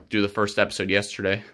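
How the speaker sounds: ordinary talk, nothing marked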